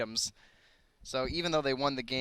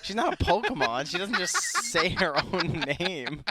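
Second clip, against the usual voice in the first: laughing; 7 semitones higher, 7 dB louder, slower than usual. Real, not acted. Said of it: "laughing protest".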